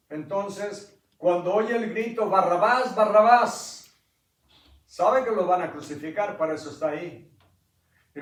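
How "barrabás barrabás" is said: louder and higher, second one drawn out slowly; crowd shout. Acted. Crowd outside the prison yelling his name, loud slow shout. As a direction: roar it like a distant crowd.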